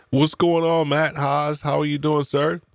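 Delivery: high, bright hello to a friend; greeting a viewer by name with high excitement; excited greeting. Real, not acted. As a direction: call out a cheery high welcome. Greeting someone in chat.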